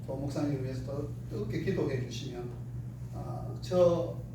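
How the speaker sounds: normal talking voice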